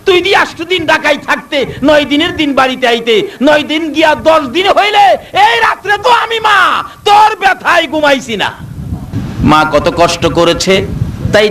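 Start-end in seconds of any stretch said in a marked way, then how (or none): 0.0-8.5 high, fast wailing reproach; grieving dead mother. Retold or imitated. Dead mother reproaches her child from the grave, wailing. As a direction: wail at the neglectful son from the grave.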